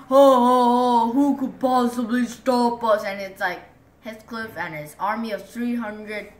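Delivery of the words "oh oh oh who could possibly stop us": loud, mocking giant voice; boastful giants. Giants gloating that nobody can stop them, loud. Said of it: "and the giants are like".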